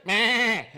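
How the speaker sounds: loud imitated sheep bleat. Shown as a demonstration. Preacher shows the congregation how the sheep cried, imitated bleat. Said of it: "Como hacen las ovejas".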